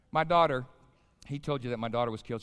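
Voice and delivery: ordinary talk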